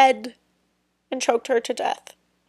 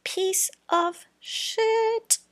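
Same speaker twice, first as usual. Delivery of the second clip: drawn out very slowly and pitched up high in contempt; disgusted insult; 10 semitones higher, much slower than usual. Real, not acted. To her viewers about the killer, a slow high contemptuous insult. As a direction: spit it out slowly like you can't stand him. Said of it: "Say it one more time, guys".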